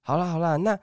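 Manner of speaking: high, sugary phone voice calling his name; sweet saleswoman caller. Retold or imitated. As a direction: coax him like a pushy recruiter. Woman caller presses. (高声甜腻的女推销员)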